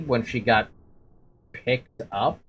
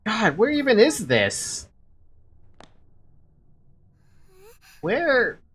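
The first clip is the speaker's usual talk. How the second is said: lightly voiced frightened schoolgirl; 7 semitones higher than usual. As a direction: wake up alone and lost. Reads Mayu lost and scared.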